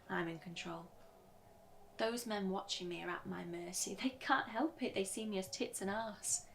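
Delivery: usual speaking voice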